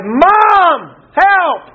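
very high, drawn-out call for mom; child's panicked cry. Acted. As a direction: imitate a kid crying out for help. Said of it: "one of them cried".

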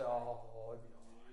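put-on mother comforting her crying child, tenderly; soothing mama. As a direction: hush a hurt child like a mama. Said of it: "some healing in Mama's voice".

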